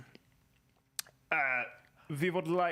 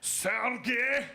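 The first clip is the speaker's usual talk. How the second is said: put-on Russian-accented call; 6 semitones higher than usual. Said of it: "Russian in".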